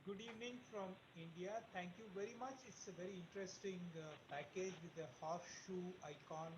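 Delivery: very faint voice; barely audible. Real, not acted. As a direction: far from the mic, hardly heard. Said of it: "your voice is very faint".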